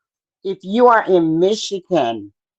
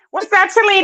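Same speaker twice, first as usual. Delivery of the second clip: much higher, bright call-out; excited greeting; 13 semitones higher, faster than usual. Real, not acted. Greeting viewer joining live.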